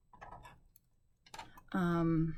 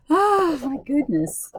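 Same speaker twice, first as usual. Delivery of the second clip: loud, high, drawn-out exclamation; loud exasperated exclamation; 8 semitones higher, 9 dB louder than usual. Real, not acted. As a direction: burst out in exasperation. Exasperated at hard scratching.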